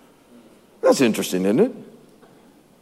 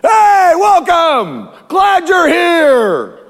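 overeager Saul; 10 semitones higher, much slower than usual. Acted. Saul welcoming Samuel with a hammed-up, much higher shout. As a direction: call out a big fake welcome, stretched slow. Saul's mock greeting to Samuel.

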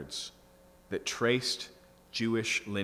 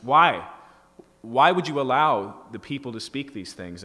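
louder, demanding why; religious leaders demanding an answer from Jesus, indignant; indignant challenge; 3 semitones higher, 10 dB louder than usual. Retold or imitated. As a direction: confront him as an offended leader. Angry religious leaders confront Jesus.

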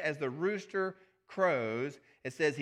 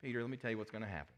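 gentle; 4 semitones lower, 7 dB quieter, much faster than usual. Acted. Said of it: "Jesus responded very gently".

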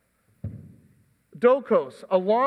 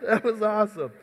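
after laughing hard. Real, not acted. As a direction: still breathless from laughter. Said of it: "let me compose myself".

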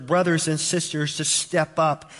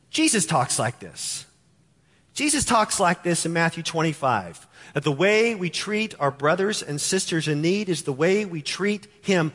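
ordinary delivery

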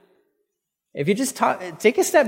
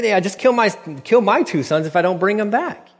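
higher-pitched, exaggerated casual offer; mock flippant offer; 5 semitones higher than usual. Reuben offers his two sons to his father, mock flippant higher voice. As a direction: imitate someone blithely overpromising.

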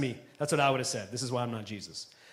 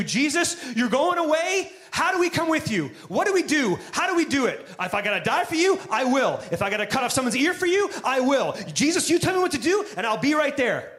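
higher, pressing, eager disciple voice; eager pleading; 6 semitones higher than usual. Retold or imitated. Disciples begging Jesus what to do, eager and pleading. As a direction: plead like you're desperate to help.

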